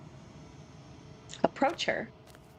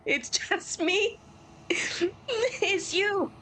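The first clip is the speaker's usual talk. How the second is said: laughing; 10 semitones higher, much slower than usual. Real, not acted.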